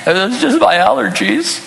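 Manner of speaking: husband mocking his own excuse to his wife. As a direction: brush it off playfully. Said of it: "his excuse to his wife".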